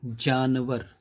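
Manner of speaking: जानवर opened with a firm J; undotted J at the start of जानवर; plain J sound. Shown as a demonstration. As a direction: say जानवर carefully with J as in jar. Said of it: "उसकी जो pronunciation है".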